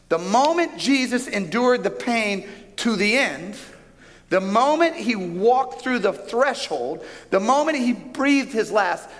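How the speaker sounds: high, slow, building preacher's cadence; rising slow sermon build; preaching climax. Acted. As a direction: lift the room with each phrase.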